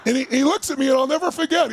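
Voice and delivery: normal talking voice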